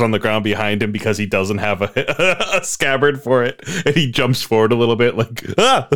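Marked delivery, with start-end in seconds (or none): 5.6-5.9 high, loud startled yelp; goofy kid yelp. Pretend. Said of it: "goofy kid Trunks".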